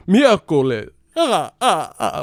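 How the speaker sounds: slow, loud vampire count with a cackling laugh; Dracula voice. Retold or imitated. The Spanish-channel count, Dracula voice. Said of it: "This motherfucker, Bram Stoker".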